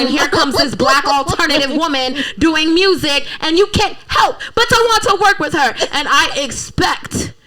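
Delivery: loud excited dreaming; excited boast. Real, not acted. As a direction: talk it up big. Dreaming of grand opening.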